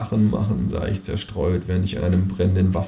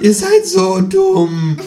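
mocking laugh; 5 dB louder, much slower than usual. A narrator's reading. Kangaroo mocks humans.

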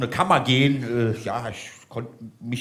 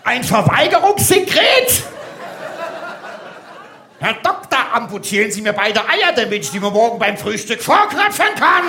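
loud, high drill-sergeant tirade; outraged shouting; 10 semitones higher, 7 dB louder than usual. Acted. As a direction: bellow in disbelief, drawing out the insults. Instructor outraged at doctor's diagnosis.

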